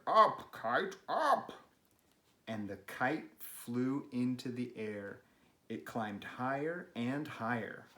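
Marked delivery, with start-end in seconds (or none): 0.1-1.5 louder call, rising high on the last up; shouted. Acted. Toad shouting at his kite, loud and rising. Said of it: "He shouted".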